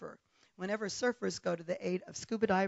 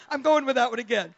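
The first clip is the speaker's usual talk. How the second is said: ordinary delivery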